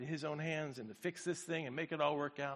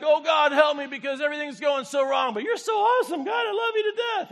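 high, loud, hammed-up swing from despair to praise; mock David; 11 semitones higher, 12 dB louder than usual. Pastor imitates David's moody psalm, loud and high. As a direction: overact David's whiplash prayer. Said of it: "he's like".

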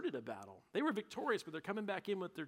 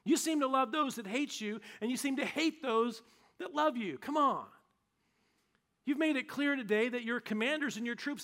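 higher, louder furious scolding; angry rebuke; 7 semitones higher, 7 dB louder than usual. Retold or imitated. Joab storming in to scold the grieving king, loud angry rebuke. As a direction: tear into the king, demanding he act. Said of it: "He says".

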